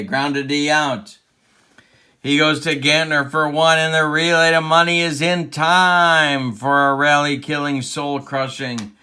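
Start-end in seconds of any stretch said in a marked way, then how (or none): 2.2-8.9 loud, rising sportscaster excitement; driving, louder play-by-play; excited double-play call. Pretend. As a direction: sell the big play as it happens. Calling a double play live.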